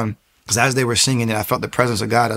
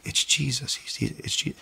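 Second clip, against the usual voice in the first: awed whisper; 10 dB quieter than usual. Retold or imitated. Awed realization of who Jesus is.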